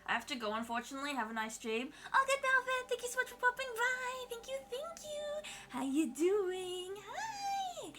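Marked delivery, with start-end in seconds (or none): 2.1-7.9 very high, squealy thanks; gushing. Pretend. Streamer thanking a viewer for popping by, gushing high. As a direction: gush sweetly way up high.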